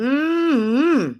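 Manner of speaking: usual speaking voice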